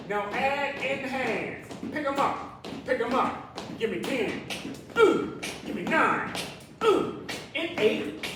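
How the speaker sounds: high, loud pumped-up rep count; excited countdown. Acted. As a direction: whoop between counts to fire them up. Counting down reps, whooping.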